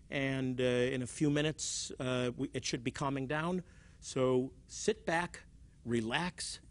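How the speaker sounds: low, unhurried pilot announcement; relaxed, low mock cockpit patter; very calm pilot. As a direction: imitate a calm captain on the intercom. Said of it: "in a very calm voice".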